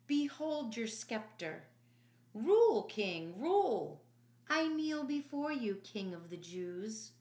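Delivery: loud, sneering mock homage; mocking soldier. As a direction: jeer at the beaten king.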